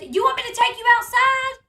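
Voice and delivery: high, loud mock threat; imitated yelling. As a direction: imitate her shouting a threat at a child. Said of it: "she yells at him".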